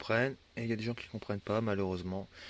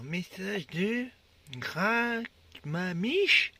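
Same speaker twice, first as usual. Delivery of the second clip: drawn out; 8 semitones higher, 6 dB louder, much slower than usual. Real, not acted. Reading a funny username.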